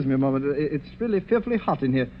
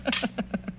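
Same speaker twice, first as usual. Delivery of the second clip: laugh; 7 semitones higher, 9 dB quieter than usual. Acted. Villain mocks host's ghost story.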